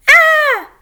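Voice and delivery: very high, loud cat yowl "Ah!". A narrator's reading. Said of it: "meowed Dad".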